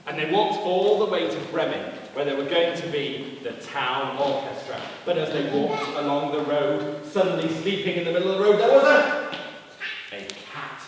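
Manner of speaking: lively storyteller, rising at the end; storytelling to children. A narrator's reading. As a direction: build up to a surprise for young listeners.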